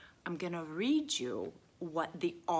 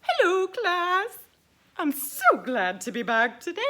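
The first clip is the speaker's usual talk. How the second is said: high, loud puppet voice; bright cheery puppet greeting the class; 14 semitones higher, 11 dB louder than usual. Acted. Puppet Missus Butterworth greeting the class, high and loud.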